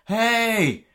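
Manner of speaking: high, loud mock proclamation; royal announcement. Royals announcing to the country, mock proclamation. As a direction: call out grandly to the nation. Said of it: "if they didn't announce".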